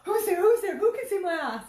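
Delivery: high, loud frantic calls; panicked calling. Retold or imitated. Mother blinded by water, high frantic calls. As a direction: shout out in a fluster. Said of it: "I'm like".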